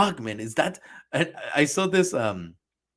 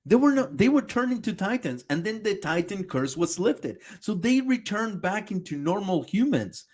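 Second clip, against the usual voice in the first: angry rebuttal; 8 semitones higher than usual. Real, not acted. Streamer yelling at the video's Titan mistake, angry. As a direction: tear into the video's claim.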